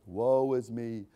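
slow, mocking woe-is-me lament; mock self-pity moan. Preacher mocks a brooding believer, slow mock lament. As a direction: drag each word out like a self-pitying complainer. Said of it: "Just ruminate about it".

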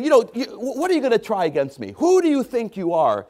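high, scornful giant's taunt; a lightly voiced mocking giant. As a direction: sneer it as the giant looking down. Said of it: "The giant is saying".

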